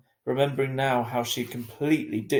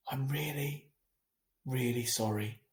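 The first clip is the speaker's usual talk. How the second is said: soft, hushed apology; whispered; 6 dB quieter than usual. A narrator's reading. Mum whispering an apology to Via, hushed. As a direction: whisper it close to her face. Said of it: "she whispered".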